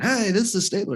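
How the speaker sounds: high, loud DJ greeting; radio DJ voice. Retold or imitated. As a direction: pump it up like a radio host. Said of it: "you sound too much like a radio D J".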